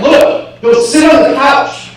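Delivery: high, loud, exasperated command; yelled inner order. Retold or imitated. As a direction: re-enact yelling an order inside your head. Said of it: "I yelled in my head".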